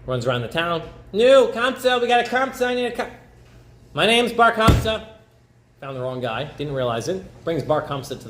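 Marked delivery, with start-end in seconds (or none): 1.1-3.1 high, loud calling out to find the right man; higher calling voice, hunting for Kamsa. Acted. Servant searching town for Kamsa. 3.9-5.0 high, loud and slow, stating his own name; raised slow reply; answering the call. Acted. The wrong man answers the servant.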